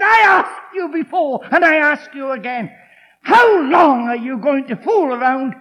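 loud, ringing rebuke to the crowd; booming challenge. Retold or imitated. Preacher thundering Elijah's challenge at the people. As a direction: thunder it like a prophet.

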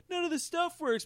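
high, mock-whining complaint; whiny customer. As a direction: whine it like a fed-up buyer. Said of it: "when you go".

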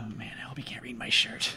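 whispered aside; whispering. Acted. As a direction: hush it under your breath. Aside while helping old man.

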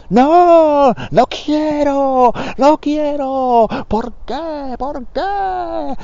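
high, drawn-out hammed-up cries of a bad actor; melodramatic shouting actor. Amateur acting students overacting in improv class, high hammed-up wailing.

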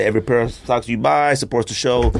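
ordinary talk, nothing marked

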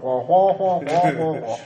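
Charlie Brown teacher trombone wah-wah; muted trombone wah wah wah; muffled wah wah talk. Retold or imitated. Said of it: "the trombone noise from Charlie Brown".

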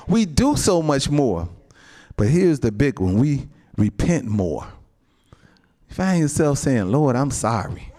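loud, driving sermon voice; rising preacher's fervor; full-force preaching. Acted. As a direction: preach it hard to the saints.

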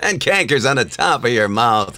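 put-on cranky old sailor; old man voice. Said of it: "You sounded a little too old".

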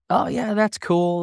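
high, drawn-out "oh yeah" of easy approval; breezy, noncommittal encouragement, voiced high; mock approval. Cheerleaders' easy approval, retold.

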